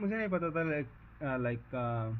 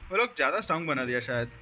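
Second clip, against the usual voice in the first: rushed; 3 semitones lower, 5 dB louder, much faster than usual. Real, not acted. Muttered complaint about boss.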